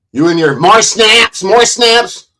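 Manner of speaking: high, loud mocking sneer; high, slow mocking jab at the streamer. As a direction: jeer at their catchphrase.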